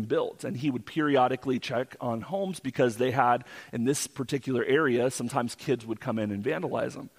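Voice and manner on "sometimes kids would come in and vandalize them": usual speaking voice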